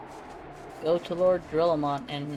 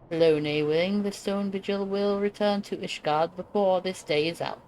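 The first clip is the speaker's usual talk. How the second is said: light British knight, a touch higher; British accent; 3 semitones higher than usual. Acted. Knight greeting the hero before the charge, British accent. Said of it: "I decided I need to do a British accent".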